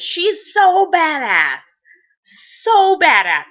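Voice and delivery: high, loud, slow, stretched awe; slow gushing. Real, not acted. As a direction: savor each word in awe. Gushing about Abby, drawn out.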